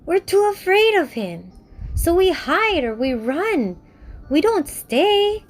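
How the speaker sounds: high, small voices owning up to fear; scared little friends. Acted. Friends confessing to Tutu they run, high and timid.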